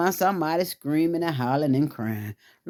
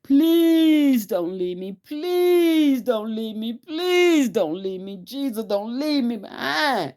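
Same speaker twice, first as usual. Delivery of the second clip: high, slow, wailing plea; desperate pleading; 9 semitones higher, slower than usual. Retold or imitated. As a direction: beg Jesus not to go, drawn out and high. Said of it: "I said".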